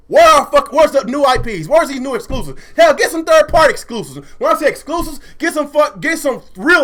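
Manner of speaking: high, punched, exasperated demands; heated rant. Real, not acted. Streamer demanding new exclusives from Xbox, heated. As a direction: fire off demands in real frustration. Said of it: "I'm a little heated".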